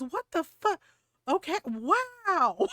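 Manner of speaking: high, stretched-out startled reaction; retold shock. Man reacting to being screamed at, stunned. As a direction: mimic a taken-aback man, drawing out the last word.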